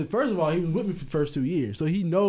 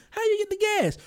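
very high, mock incredulous question; questioning dad; 14 semitones higher than usual. Dad asking his son about the gas, high mock question. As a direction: ask it like a shocked dad.

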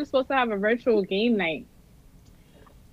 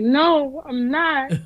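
retold whiny high; 6 semitones higher than usual. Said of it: "I'm like".

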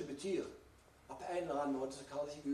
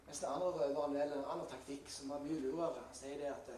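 ordinary delivery

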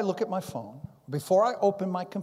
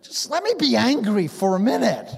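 higher, louder mock-angry retort; acted anger; 4 semitones higher, 5 dB louder than usual. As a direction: act out being angry for no reason. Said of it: "find myself getting angry".

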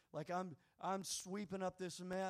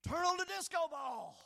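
excited call; 10 semitones higher than usual. Retold or imitated. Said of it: "And I say, "Rodney".

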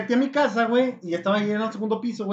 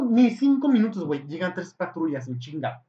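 ordinary delivery

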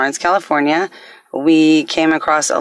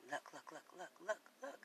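hushed; 35 dB quieter, faster than usual. Acted. Hushed creepy repetition.

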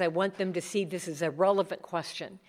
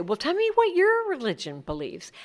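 curious question; 10 semitones higher, 3 dB louder than usual. Retold or imitated. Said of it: "then they always say".

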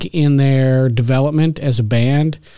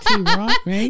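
put-on lightly laughing New Zealand accent; mock New Zealand accent; 5 semitones higher than usual. Cohost hunched up doing a jokey New Zealand accent. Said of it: "my New Zealand accent".

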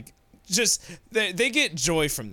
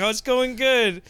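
higher, anxious mock reply; nervous store manager; 6 semitones higher, 3 dB louder than usual. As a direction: play the manager trying to sound fine. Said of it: "store manager shaking in fear".

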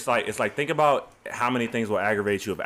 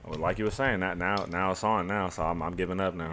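muffled behind a hand; hand over mouth; 5 semitones lower, 4 dB quieter than usual. Real, not acted. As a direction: talk through your hand. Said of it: "You got your hand over your mouth".

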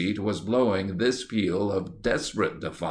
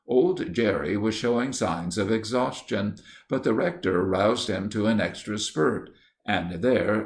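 ordinary delivery

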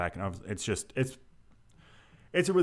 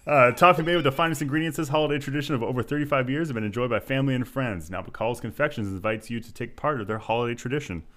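upbeat sponsor read, a bit higher and brighter; 5 semitones higher, 3 dB louder than usual. Acted. Host reading a toffee sponsor ad to listeners, upbeat ad voice. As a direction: sell the toffee like a radio spot.

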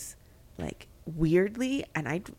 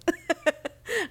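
high burst of laughter; 11 semitones higher than usual. Real, not acted. Friend pointing at her.

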